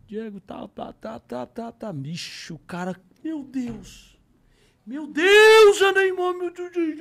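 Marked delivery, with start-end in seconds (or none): 4.9-7.0 high, loud starstruck yell; a fan screaming with joy that fades toward the end; ecstatic scream. Retold or imitated. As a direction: shriek it like a thrilled fan. Shop owner freaks out over Neymar.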